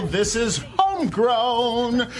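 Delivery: drawn-out show intro call; radio announcer shout. Acted. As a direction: announce the show name big. Host announcing show name.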